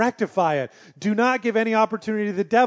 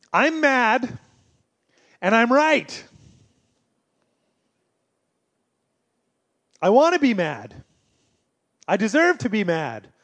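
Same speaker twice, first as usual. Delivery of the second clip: self-righteous; 5 semitones higher, 4 dB louder, slower than usual. Retold or imitated. Voicing self-righteous anger.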